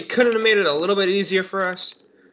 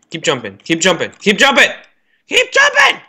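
escalating shout; 3 semitones higher, 5 dB louder than usual. Real, not acted. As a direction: urge them on harder each time until yelling. Urging players, escalating.

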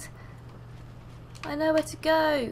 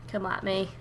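normal talking voice

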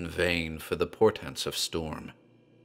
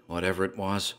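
plain reading voice

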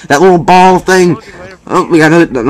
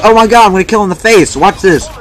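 excited screaming; 7 semitones higher than usual. Real, not acted. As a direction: scream it out in triumph. Earns the tactical nuke.

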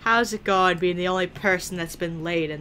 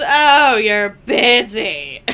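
loud, drawn-out mock complaint; mocking imitation; 2 semitones higher, 8 dB louder, much slower than usual. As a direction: tease him loudly, stretching it out. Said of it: "everything that he's".

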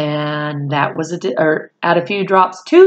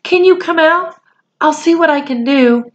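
put-on much higher, urgent boy's voice; anxious boy; 9 semitones higher than usual. A narrator's reading. Boy anxiously coaxing the wounded Indian out of the box. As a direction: rush to help, worried.

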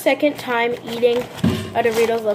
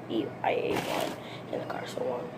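whispering; 10 dB quieter than usual. Real, not acted. Confessing eating in the car.

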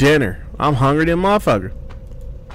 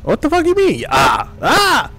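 high, startled cry of disbelief; shocked yelp; 13 semitones higher than usual. Real, not acted. Gamer yelling at a girl who vanished, shocked. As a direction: pitch shoots up as she vanishes.

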